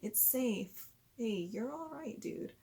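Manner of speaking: put-on calm a frightened child down; soft reassuring. Shown as a demonstration. Parent soothing a scared child, soft and reassuring. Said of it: "as you're telling them".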